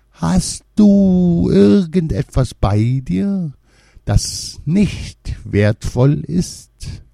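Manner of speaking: high, slow secretive crone; mysterious old woman. A narrator's reading. Old woman asking the maid for something, slow and secretive. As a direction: ask slowly, full of mystery. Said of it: "nickte geheimnisvoll und fragte".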